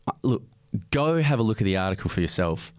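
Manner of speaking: ordinary delivery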